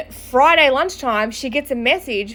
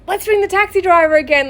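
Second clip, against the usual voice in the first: higher-pitched imitation of an eager friend; eager suggestion; 7 semitones higher, 3 dB louder than usual. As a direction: pitch up a bright idea, retold.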